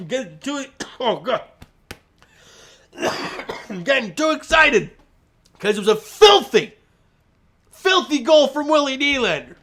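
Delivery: panting after a coughing fit; breathless, gasping between words; out of breath. Real, not acted. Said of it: "I gotta catch my breath there".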